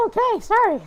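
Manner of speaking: high, squeaky apology through laughter; real laughter. Real, not acted. Reader apologising after an overlong scream, laughing. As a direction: apologise while cracking up.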